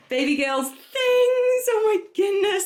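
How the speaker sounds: high, slow sing-song squeal; sing-song gush. Real, not acted. Gushing over baby things.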